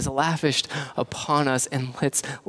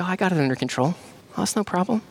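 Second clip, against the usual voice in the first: mock dismissive sinner; 5 semitones higher, faster than usual.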